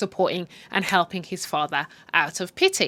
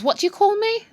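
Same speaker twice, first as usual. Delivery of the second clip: mock outrage; 13 semitones higher, 4 dB louder than usual. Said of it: "she goes".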